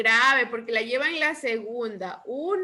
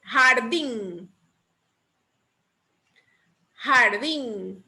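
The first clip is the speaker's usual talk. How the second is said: Jardín with DÍN held out; Jardín said carefully, second syllable emphasized; stress on Jardín's last syllable; much slower than usual. Shown as a demonstration. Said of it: "lleva el acento en la última sílaba".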